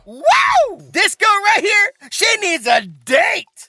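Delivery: high, loud whooping cheer; hype cheer. Acted. Narrator cheering on Guild Girl, high excited woo. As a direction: cheer her on like a hype man.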